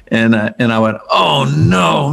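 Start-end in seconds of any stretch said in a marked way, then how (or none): 1.1-2.1 higher, retold inward groan of dismay; mock dismay. Caller to himself on hearing the agent, mildly exaggerated dismay. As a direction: sink into a comic "oh no".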